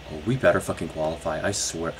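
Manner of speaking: quiet fast threat under breath; tense mutter. Real, not acted. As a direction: mutter it through the stress.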